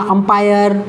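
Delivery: usual speaking voice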